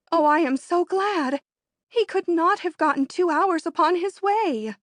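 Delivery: high, loud and glad; excited. Acted. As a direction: burst out with relief.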